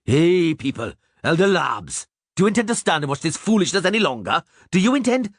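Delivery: high, indignant appeal to the crowd; Zoltan's angry shout. A narrator's reading. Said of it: "Zoltan shouted angrily".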